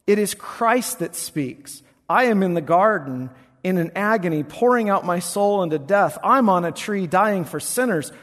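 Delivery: loud, high Baptist revival preaching; hammed-up Baptist pulpit voice; Baptist preaching style. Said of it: "in my best Baptist style".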